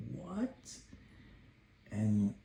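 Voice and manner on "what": high, quiet what; dad marveling, high and soft; soft amazed what. Retold or imitated. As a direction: gasp it in wonder.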